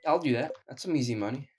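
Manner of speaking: usual speaking voice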